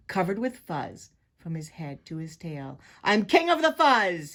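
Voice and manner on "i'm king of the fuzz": loud, high boasting snarl; forceful villain's boast. A narrator's reading. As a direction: crow it aggressively as a self-crowned bully. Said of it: "he snarled".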